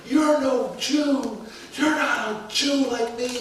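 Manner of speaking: high, drawn-out indignation without real volume; mock angry scream. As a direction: imitate an angry yell at restrained volume. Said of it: "Angry, starts screaming at him".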